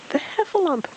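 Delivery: very high squeal of alarm; Piglet. A narrator's reading. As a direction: squeal it out twice, far up in pitch. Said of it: "Piglet said again".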